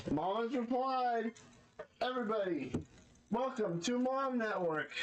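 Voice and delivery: announcer voice. Pretend. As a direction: hype it like a TV host. Announcing mom show, high.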